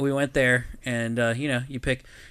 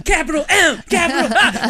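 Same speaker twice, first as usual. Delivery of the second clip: high, loud shout, letter by letter; defiant shouted spelling; 14 semitones higher, 8 dB louder than usual. Retold or imitated. Drunk friend spelling his name at a cop, defiant shouting. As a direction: bellow each letter like a belligerent drunk.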